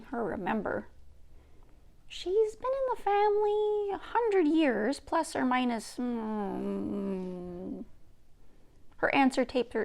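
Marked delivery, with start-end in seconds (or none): 2.1-7.8 higher, slow remembering grandmother; an old woman drifting into memory; Nokomis. Acted.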